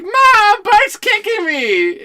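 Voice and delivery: put-on high, loud, drawn-out whine of a kid sister; whiny Lisa. Lisa tattling to mom on Bart, high whiny squeal. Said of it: "and she was like".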